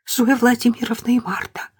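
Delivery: tearful whisper. A narrator's reading. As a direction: whisper it through tears. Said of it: "прошептала девушка".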